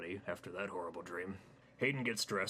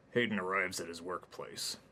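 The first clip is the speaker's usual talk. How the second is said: usual speaking voice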